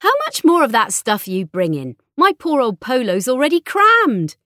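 loud, high-pitched exasperation; stressed complaint. A narrator's reading. Mother complaining her car is crammed, stressed and loud. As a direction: wail loudly about all the stuff.

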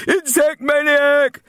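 very high, slow, loud jeer; shouted insult. Acted. Bully shouting at the old man's back from the fence, loud jeer. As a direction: hurl it at his back.

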